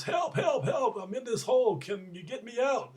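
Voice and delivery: high, pleading mock shout; retold yell for help. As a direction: call out to a passer-by, acting trapped. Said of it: "the man yells".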